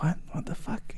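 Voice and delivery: hushed, stunned disbelief; horrified whisper. Acted. He sees blood on his hands, horrified whisper. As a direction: breathe it out in shock.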